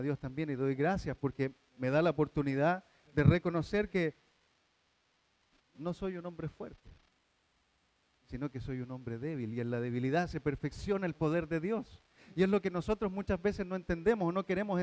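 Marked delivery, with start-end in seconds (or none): 5.7-9.5 humble admission. Real, not acted. Preacher softly confessing he is weak. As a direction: let it sink, quietly. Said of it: "confessing weakness".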